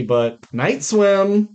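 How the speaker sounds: ordinary talk, nothing marked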